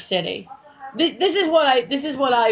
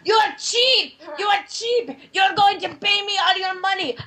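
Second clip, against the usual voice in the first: higher-pitched mock accusing rant; angry cab driver; 5 semitones higher than usual. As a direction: imitate an irate cabbie demanding payment. Acting out the angry driver chasing.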